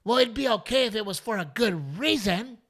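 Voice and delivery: high, dismissive mock voice; mock heckler woman. Heckler woman waving off the comic's excuse, high mock voice. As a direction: brush him off like a stubborn fan. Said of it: "she goes".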